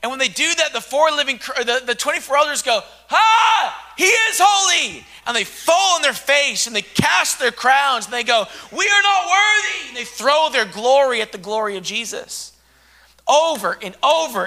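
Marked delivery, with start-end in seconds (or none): 3.1-5.0 high, loud cry of awe; awed shout. Acted. Elders crying out in worship. 8.7-9.9 humbled shout. Acted. Elders casting crowns, crying out.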